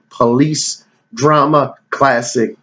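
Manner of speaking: usual speaking voice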